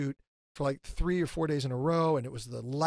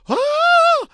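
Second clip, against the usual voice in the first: much higher, loud scream of shock; acted scream; 15 dB louder than usual. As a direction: shriek as if you see it. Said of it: "And I scream like it's there".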